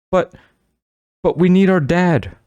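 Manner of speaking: high, nervous stammer, repeating the first word; a touch of frightened stammering. A narrator's reading. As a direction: stumble out the words in panic, voice jumping up. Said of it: "Marissa stammered".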